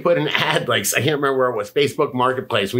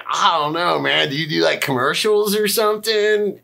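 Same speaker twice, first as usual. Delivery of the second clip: clueless guessing; 7 semitones higher than usual. Retold or imitated. Retelling his guess at the photographer's day job, puzzled and high. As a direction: rise higher towards a puzzled question.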